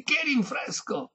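high, slow indignant outburst; exclamation. A narrator's reading. Violinist refusing refreshments, high exclamation. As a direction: scoff high and drawn out. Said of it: "esclamò questi".

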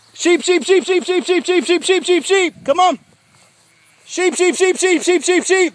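high, loud sing-song livestock call; calling the sheep. Real, not acted. Farmer calling his sheep over to him, loud high sing-song call. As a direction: holler it high across the pasture to bring the flock.

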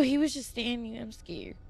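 ordinary delivery

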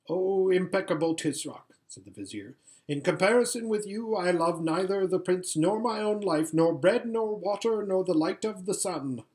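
high, fawning flatterer; oily scheming vizier. Acted.

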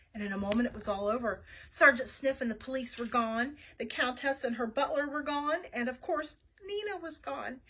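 usual speaking voice

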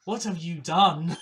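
loud, drawn-out dramatic outcry; horrified accusation. Acted. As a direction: cry it out slowly, aghast. Acted accusation in game dialogue.

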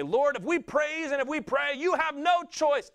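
high, loud mock plea that presumes the answer; demanding prayer. Believers pressing God in prayer, high mock insistence. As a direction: chant it up high, stretching the last words. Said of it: "We'll say".

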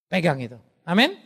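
ordinary talk

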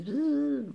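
high mock buzzing "zzzz"; buzzing noise. Crafter jokes her brain is foggy, mock buzz.

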